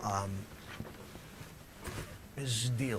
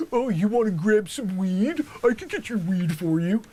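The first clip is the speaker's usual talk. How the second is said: much higher, loud peppy pitch; mock LA dealer; 12 semitones higher, 12 dB louder than usual. Dealer mocking LA rivals, high and loud. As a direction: ham up the eager LA seller. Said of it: "they're like".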